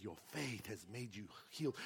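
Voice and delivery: low, soft, tender; gentle blessing. Retold or imitated. Jesus gentle, much lower softer.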